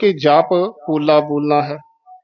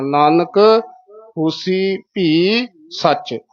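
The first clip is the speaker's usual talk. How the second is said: slow ਹੋਸੀ ਭੀ, held before ਸੱਚ; measured pauses; much slower than usual. Shown as a demonstration. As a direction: pause after Nanak and again before ਸੱਚ. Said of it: "ਇਸ ਤਰ੍ਹਾਂ।". (ਨਾਨਕ, ਹੋਸੀ ਭੀ, ਸੱਚ ਠਹਿਰਾ ਦੇ ਕੇ)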